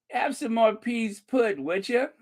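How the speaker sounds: high-pitched hammed-up Brit; mock British accent. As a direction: offer it like a comic British host. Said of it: "I love the way they drop that consonant".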